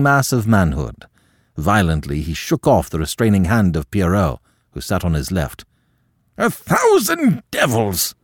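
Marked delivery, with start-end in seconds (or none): 6.4-8.1 high, slow furious oath; enraged roar. A narrator's reading. Insulted troupe leader cursing at the table, enraged roar. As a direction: roar it out in outrage. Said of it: "he roared".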